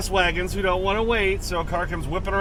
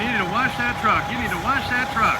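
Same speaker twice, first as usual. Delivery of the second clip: higher-pitched mock nagging; lightly mocking quote in a raised pitch; mimicking nagging viewers; 5 semitones higher, faster than usual. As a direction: imitate insistent commenters, pitched up. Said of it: "all of those of you who keep saying".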